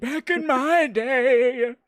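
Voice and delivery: put-on high, loud, slow crotchety old man; hammed-up old geezer voice; grave digger old man. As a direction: drag it out like an ancient grave digger. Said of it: "grave digger kind of old man".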